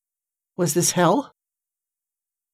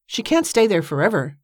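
usual speaking voice

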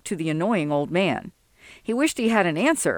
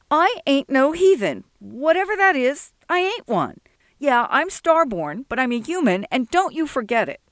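put-on higher, defiant young voice; indignant young Sol; 7 semitones higher than usual. Sol rejecting Jesse's insult, a higher indignant voice. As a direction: bristle at being called a heathen.

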